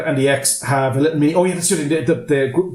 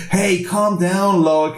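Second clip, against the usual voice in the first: slow, higher put-on calming voice; mock reassurance; 5 semitones higher, much slower than usual. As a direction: draw it out, playing the would-be hero. Smug ex acts soothing the panicker.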